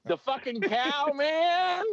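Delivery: slow, high stoned drawl; The Dude impression. Officer quoting the suspect, as a slow high Dude-like stoner. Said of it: "talking to Jeff Bridges on The Dude".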